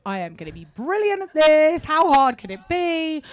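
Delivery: loud, high, overconfident; big mock-cocky self-belief; retold cocky boast. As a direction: brag breezily, stretching the last question. Retelling her cocky audition confidence.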